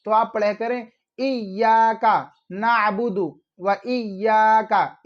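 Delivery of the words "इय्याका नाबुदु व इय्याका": इय्याका drawn long on the "yaa"; slow model reading with long इय्याका; stretched "ya". Shown as a demonstration. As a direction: hold the ya in इय्याका, end नाबुदु on u. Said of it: "या को खींचा करें थोड़ा सा".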